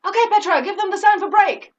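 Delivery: high, loud called-out command; director's cue. Retold or imitated. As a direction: call the cue briskly over a headset. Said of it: "I hear the director".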